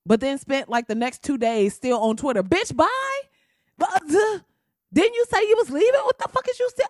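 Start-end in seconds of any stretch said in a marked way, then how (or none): 2.4-6.9 high, loud sassy jeer; mocking taunt. Comedian taunting Roseanne in a high sassy jeer. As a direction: needle her with a high sneer.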